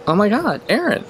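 put-on wife's high, startled recognition of an old face; imitating a sudden realization; surprised gasp. Wife spotting the teacher, high surprised cry. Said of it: "she goes".

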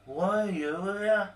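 high put-on charming voice; chat-up mode. Don chatting up the woman outside, high flirty voice. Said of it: "went straight into his chat-up mode".

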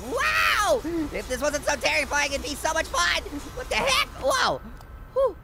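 ordinary talk, nothing marked

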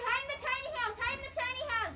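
high, soft, urgent hiding voice; squeaky hushed play panic; hushed urgency. Pretend. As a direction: urge them to hide, keeping it small. Hiding from owner in pretend burglary.

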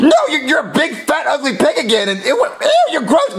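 high disgusted yelling; recoil, shouting in horror; disgusted shock. Acted. Kiss turns her into ogre.

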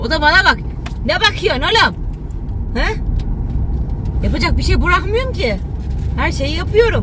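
high, loud mock indignation; mock confrontation. Challenging someone who is staring, high mock indignation. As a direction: pick a playful fight at high pitch.

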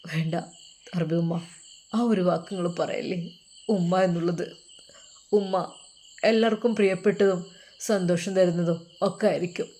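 wounded son. Acted. Son who never had his mother's love, wounded. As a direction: speak quietly through old hurt.

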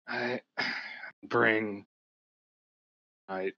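halting hoarse rasp, fading to a whisper. Acted. Exhausted priest apologizes to his visitors, very hoarse. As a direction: lose your voice word by word, with long gaps. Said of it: "he says with a very hoarse voice".

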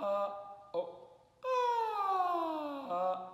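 sung slide with an obvious break; audible voice break. Shown as a demonstration. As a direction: let the voice crack at the break. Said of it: "I tried to make it really obvious".

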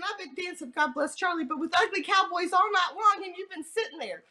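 lifted, mock-exasperated thought; amused exasperation. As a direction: tease him in your head, pitch lifted. Inner thought, teasing exasperation.

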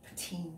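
put-on Poutine. said quietly as "oo-TEEN". Shown as a demonstration. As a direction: drop the voice on Poutine. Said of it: "I don't know how you say it".

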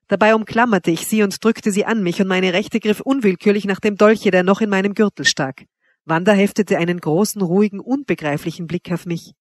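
ordinary delivery, nothing marked

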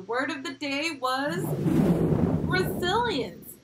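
excited reveal. Acted. Teacher reveals word of the day.

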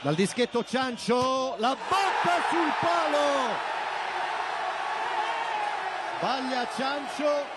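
breathless italian goal commentary. Acted. Shootout penalty hits post.

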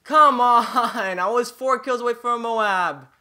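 loud, high, drawn-out wail of protest; frustrated yelling. Real, not acted. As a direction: shout the complaint in dismay. Protests losing four kills short.

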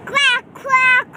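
loud quacking duck. Pretend. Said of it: "What about the duck?".

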